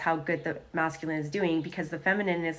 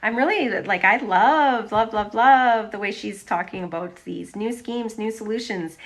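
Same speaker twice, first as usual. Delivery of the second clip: higher and louder, gushing; excited; 3 semitones higher, 7 dB louder than usual. Real, not acted. Reader gushing over the card's message, excited. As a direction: rave about it with real delight.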